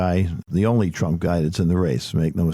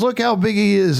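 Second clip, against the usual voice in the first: put-on high, louder child's demand; challenging little boy; 13 semitones higher, 4 dB louder than usual. Grandson challenging Papa, high and loud. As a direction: demand proof like a stubborn kid. Grandson Elliot voiced arguing.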